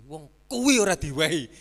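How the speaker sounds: loud mock exclaim; loud punchline. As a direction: burst out with the punchline.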